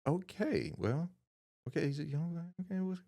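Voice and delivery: high, hesitant, unsure "okay"; sizing up a young guy warily; wary customer voice. Retold or imitated. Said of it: "they're like".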